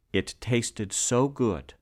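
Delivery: ordinary delivery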